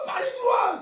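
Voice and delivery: high urgent call to come inside; wife calling her husband inside, urgent and high. Retold or imitated.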